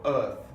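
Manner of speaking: EARTH with the R silent; British non-rhotic R. Shown as a demonstration. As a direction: say "earth" the British way, vowel straight into TH. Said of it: "in standard British English, we would say".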